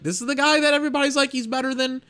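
high, fast, pitch climbing in disbelief; can't believe what you're seeing, voice up high; stunned fan mocking the hype, high and quick; incredulous disbelief. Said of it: "I was just like".

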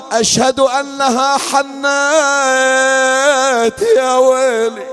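chanted grieving storytelling; mournful majlis narration; lament recitation. Acted. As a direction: intone the tale as a mourning chant.

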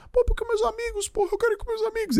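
put-on high, fast whiny child pleading with his father; crying child voice. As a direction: plead like a crying boy who wants his friends. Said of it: "Chorei, chorei".

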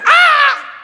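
sudden high yelp of fright; startled yelp. Retold or imitated. Worker nearly falling from scaffolding, startled high yelp. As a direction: yelp like you nearly fell.

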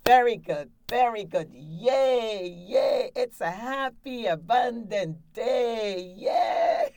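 loud, high cheering that rises to a squealed yay; excited cheer. Shown as a demonstration. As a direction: cheer like you just won a million dollars. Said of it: "you're real excited and happy".

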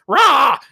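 high, loud squawking roar; giant bird roar. Acted. The giant bird shrieking at the lemur, loud roar. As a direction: let out a monster bird's cry. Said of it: "the bird looks down and goes".

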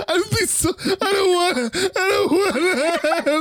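high, whimpering mock cry; mock scared. Man imagines being grabbed by her, mock-scared whimper. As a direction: whine it like a frightened victim, hammed up. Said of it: "I'm just so scared".

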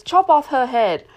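loud sudden command; barked order. Acted. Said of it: "Duchess orders a beheading".

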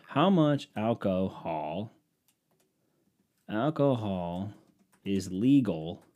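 typing out a query word by word, slow; word by word, like typing into a search bar. Acted. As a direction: read the question slowly as if typing it.

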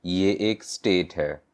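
usual speaking voice

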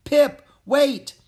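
high, loud call after someone. Acted. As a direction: call out to stop him. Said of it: "I called after him".

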